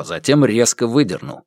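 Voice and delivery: ordinary delivery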